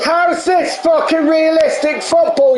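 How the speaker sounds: high indignant yell; angry shout. Real, not acted. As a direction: demand it in outrage. Player raging at game.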